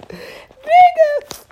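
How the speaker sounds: very high, drawn-out squeal; squealed word. Retold or imitated. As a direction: squeal it playfully. High playful call.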